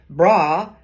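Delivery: loud casual "Yo, dude"; breezy, loud slangy call; informal greeting. Shown as a demonstration. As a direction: hail a buddy across the hall. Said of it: "instead of saying".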